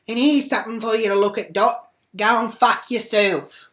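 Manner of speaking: loud, high send-off; retold parting insult. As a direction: hurl it as you walk away. Retold parting insult while walking off.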